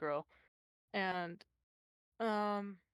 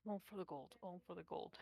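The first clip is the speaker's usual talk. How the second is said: mumbling; 8 dB quieter, faster than usual. Acted. Goliath grumbling to herself while jabbing her sword down, mumbling. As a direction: mumble it softly to yourself. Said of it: "you just hear her mumble".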